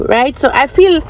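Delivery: ordinary talk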